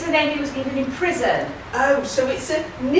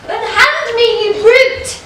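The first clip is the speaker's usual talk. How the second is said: higher, louder outraged squawk; shrill outrage from a panto captive; indignant panto dame; 9 semitones higher, 6 dB louder, slower than usual. Acted. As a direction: play the affronted ugly sister. Captive sister protesting.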